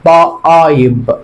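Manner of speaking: بايب drawn out very slowly, long "aay" after the b; slow teaching pronunciation. Shown as a demonstration. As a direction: stretch بايب so the long I is unmistakable. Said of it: "آ، آ،".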